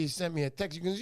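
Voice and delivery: normal talking voice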